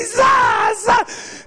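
high, sharp mock whip cracks; imitated lashes. Mom lashes the child, high impact noises. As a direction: act out each blow with a high cry.

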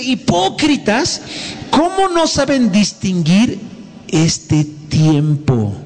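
high, slow accusing cry; quoting Jesus, high and slow; scornful rebuke. Acted. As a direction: hurl Hipócritas at the crowd. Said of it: "Pero dice".

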